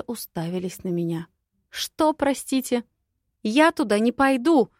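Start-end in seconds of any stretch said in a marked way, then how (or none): 1.7-4.7 high, loud, outraged refusal; indignant refusal. Acted. Heroine refusing to go to the swamp, outraged and high. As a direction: snap back, refusing flatly. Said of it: "отрезала и вскочила на ноги".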